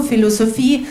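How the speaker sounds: ordinary talk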